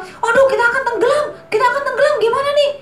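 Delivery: high, fast panicked cry; panicky disciples. Pretend. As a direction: wail that the boat will sink. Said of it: "Ada yang teriak-teriak".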